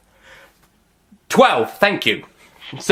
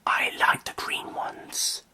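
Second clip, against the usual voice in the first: whisper; 9 dB quieter, slower than usual. Acted. Whispered aside.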